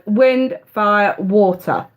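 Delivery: ordinary talk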